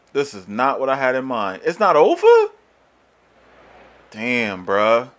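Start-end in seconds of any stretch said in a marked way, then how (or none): none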